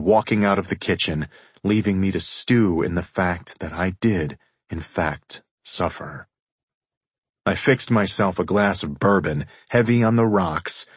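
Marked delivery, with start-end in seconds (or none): 4.7-6.3 quiet. A narrator's reading. Narrator's heavy admission.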